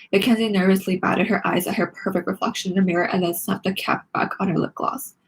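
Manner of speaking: plain reading voice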